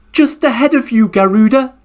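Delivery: high, smug little turtle voice; turtle's cheeky reply. Acted. Turtle answering the flying Garuda bird, high and teasing. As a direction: tease the bird from ahead. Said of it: "the answer came back".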